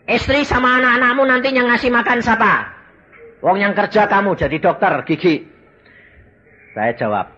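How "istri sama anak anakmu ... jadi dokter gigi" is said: taunting devil. Retold or imitated. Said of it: "Setan ngomong".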